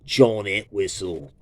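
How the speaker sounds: ordinary delivery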